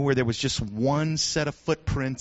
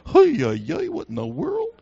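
startled congregation; 12 semitones higher, 4 dB louder than usual. Pretend. Churchgoers reacting to someone crying out, mock shock. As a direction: act a startled onlooker, pitched way up. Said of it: "everybody would go".

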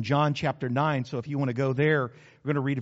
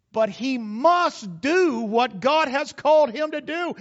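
raised, slowed, pounding emphasis; preaching at full force; 7 semitones higher, 5 dB louder than usual. Acted. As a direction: hammer each must to the congregation. Sermon build.

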